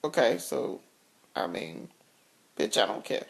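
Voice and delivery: indifferent husband. Retold or imitated. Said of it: "The look on his face was more or less like".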